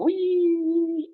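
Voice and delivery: a high, gleeful whee as she folds forward; playful whee. Real, not acted. Instructor folding forward for viewers, a high gleeful whee. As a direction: sing out a high whee while dropping into the stretch.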